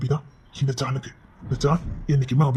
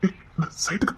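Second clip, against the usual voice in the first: whisper; 7 dB quieter than usual. Acted. Hushed, leaving for work.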